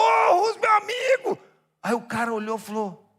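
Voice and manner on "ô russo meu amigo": very high, loud, delighted shout; hearty greeting. Retold or imitated. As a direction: hail a dear friend across the room. Acted joyful greeting of a friend.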